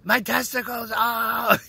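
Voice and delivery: high, loud mock cry of pain; hammed-up kid wailing over an injury; hurt young child. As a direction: wail it childishly, breaking into a laugh at the end. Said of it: "I'm like".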